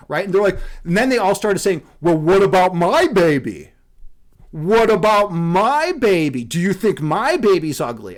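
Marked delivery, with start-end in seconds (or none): 2.0-3.7 louder indignant mom voice; offended mother. Retold or imitated. Mothers demand an answer about their own baby, indignant. 4.5-8.2 offended mother. Retold or imitated. Mother presses the streamer about her baby, slow and hurt.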